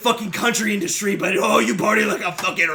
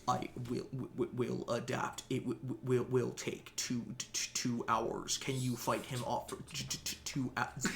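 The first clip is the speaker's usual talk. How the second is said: stuttering glitching AI; 8 semitones lower, 17 dB quieter than usual. Acted. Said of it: "Helix goes".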